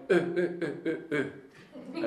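a stalling engine sound, voiced then breaking into a laugh; half-laughing imitation of a car coughing out; sputtering car engine. Telling friends how the Beetle ran dry, mock engine splutter. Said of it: "than the car went".

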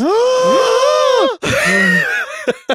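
sharp high gasp of mock outrage; shocked gasp. Real, not acted. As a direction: gasp as if scandalized. Shocked at penis joke.

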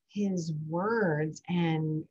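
ordinary talk, nothing marked

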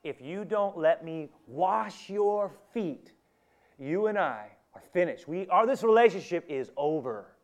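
forceful, drawn-out final warning; strong statement. Acted. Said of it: "That's a pretty strong statement".

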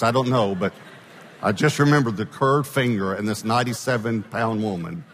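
usual speaking voice

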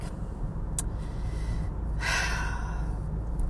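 a long, tired sigh out; weary sigh. Retold or imitated. Worn out by her cursing dad, weary sigh. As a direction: let out a long exhausted breath. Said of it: "and you're just like".

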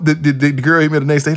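ordinary talk

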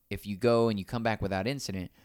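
usual speaking voice

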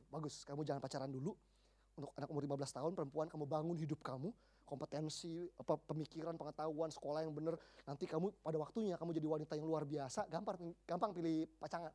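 low, soft mentor's advice; calm counselling. Retold or imitated. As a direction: quietly advise a young girl. Preacher counselling a fifteen-year-old girl.

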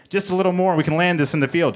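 urgent high-pitched announcement; announcing. A narrator's reading. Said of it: "Joe announced".

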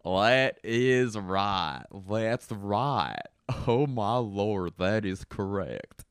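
slow, drawn-out Southern drawl; hammed-up Southern twang, stretched vowels; Southern accent. Host launching a tour down South, thick slow Southern accent. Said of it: "start with the Southern accent".

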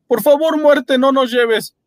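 high, slow, pleading wail; Paolo begging. A narrator's reading. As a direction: beg for your life. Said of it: "dijo Paolo".

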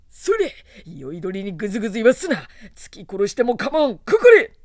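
loud, fast angry bellow rising to a scream; furious roar. A narrator's reading. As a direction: roar the order in rage. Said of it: "と怒号した".

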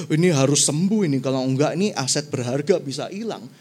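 low king's voice, fast and urgent; the king frets over losing his asset, low and quick; insistent king. Acted. King insisting his commander be healed, low and urgent.

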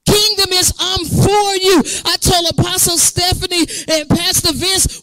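high, emphatic preacher's cry; impassioned preaching. Real, not acted. Preacher vowing never to let fellow pastors go, fervent preaching.

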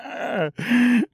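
high, mocking clown cackle; clown's cackle. A narrator's reading. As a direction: cackle like a jester. Clown laughs at them.

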